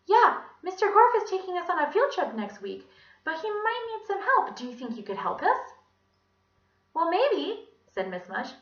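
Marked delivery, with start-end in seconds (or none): 0.1-5.6 put-on high little-girl voice; Allison. A narrator's reading. Villain posing as a pupil through the door, girlish. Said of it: "said in Allison's voice". 6.9-7.7 high, singsong lady; musing Miss Mush. A narrator's reading. Visitor considering the pupil's request, high singsong.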